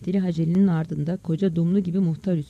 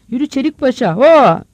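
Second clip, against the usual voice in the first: raised call ending in a big shout; driving an ox; 5 semitones higher, 10 dB louder than usual. Acted. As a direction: urge the animal on loudly. Farmer drives his ox. (öküzü sürer gibi bağırarak)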